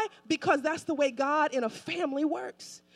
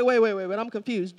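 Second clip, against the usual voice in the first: low, puzzled interruption as a baffled disciple; confused; 5 semitones lower, 4 dB louder than usual. Acted. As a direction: halt the teacher in mock confusion. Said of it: "Philip is like".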